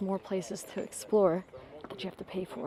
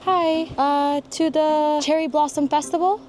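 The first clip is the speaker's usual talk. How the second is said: louder, higher question to a foreign driver; raised voice for the driver; 7 semitones higher, 9 dB louder than usual. Real, not acted. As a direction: speak up so the taxi driver understands. Asking taxi driver for destination.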